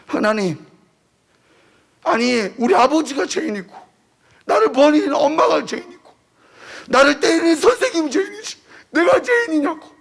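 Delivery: crying prayer. Retold or imitated. Orphaned teen crying out to God at the retreat, tearful and rising. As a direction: plead in anguish, voice breaking upward. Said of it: "막 울기 시작한 거예요".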